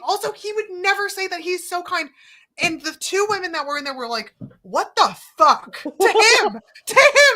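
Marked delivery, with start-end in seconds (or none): 4.6-7.4 loud, aghast retelling; high, loud scandalized cry; retold outrage. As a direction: exclaim it in mock horror. Retold outraged coworkers, narrator aghast.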